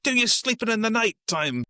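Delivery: high-pitched, put-on cabbie holler; mock taxi driver yell. Taxi driver at Redford who nearly got run over, mock yelling. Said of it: "the taxi driver who yells at".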